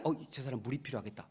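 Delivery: soft, fast thought muttered to oneself; quick quiet aside. Retold or imitated. A servant noticing a thirsty guest, quick soft aside. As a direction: say it under your breath as if just noticing. Noticing someone needs water.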